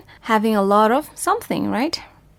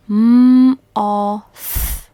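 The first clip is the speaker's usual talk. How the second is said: slow and louder, m-o-th spaced out; slow sounding out; 6 dB louder than usual. Shown as a demonstration. Teacher sounding out moth for pupils. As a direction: spell out m-o-th slowly and clearly. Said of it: "One more time".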